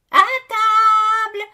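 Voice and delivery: high, long-drawn call to dinner; sing-out yell, very loud; mother's shout. A narrator's reading. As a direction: holler it through the house, stretched out. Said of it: "crie ma mère".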